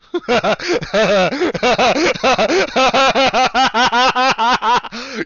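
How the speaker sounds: high, loud burst of laughter; cracking up at the chat; loud laughing fit. Real, not acted. Player cracks up at a friend's typed joke, loud laughter. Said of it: "Y'all in the video can't see it".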